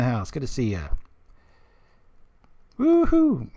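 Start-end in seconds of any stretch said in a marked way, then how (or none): 2.8-3.5 high whooping cheer. Real, not acted. Streamer cheering as Killer Tofu joins chat, whooping. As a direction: whoop it up for a friend arriving.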